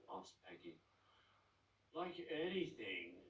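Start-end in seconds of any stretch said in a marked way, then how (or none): 1.9-3.2 groaned. Acted. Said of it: "groaned Joan".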